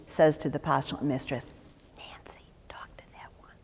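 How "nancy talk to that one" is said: hushed conspiratorial whisper, barely voiced; whispered aside. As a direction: whisper it so the girl can't hear. Mrs Minch tips off the postulant mistress.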